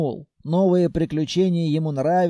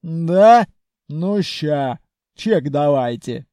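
gruff, curt bass; troll-like bass; 7 dB louder, slower than usual. A narrator's reading. Khalya demands the receipt from buyers, gruff troll-like bass. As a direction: grunt it like a troll.

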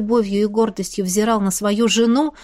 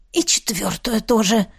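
hoarse. A narrator's reading. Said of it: "хрипло отозвался Антарес".

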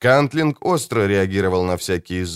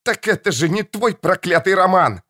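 screaming daughter; 11 semitones higher than usual. Acted. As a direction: scream it at him. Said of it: "закричала она".

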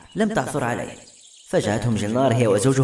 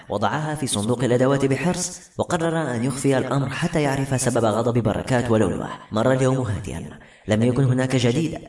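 ordinary delivery, nothing marked

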